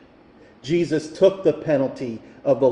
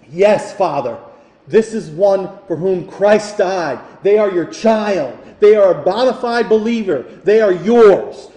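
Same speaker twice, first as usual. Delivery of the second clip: pleading advocate; 6 semitones higher, 8 dB louder than usual. Acted. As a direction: argue the case for the child. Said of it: "the Spirit says to the Father".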